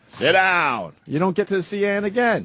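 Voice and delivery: hammed-up command to sit; mock stern order. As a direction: bark it like a joke authority. Joking stern order to guest.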